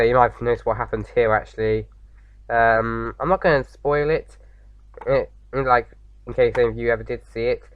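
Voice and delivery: ordinary talk, nothing marked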